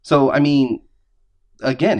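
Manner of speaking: ordinary talk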